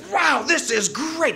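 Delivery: high, loud, slow delight; elated. Retold or imitated. Flying down the hill, slow high elated cry. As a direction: stretch out the joy. Exhilarated on the slope.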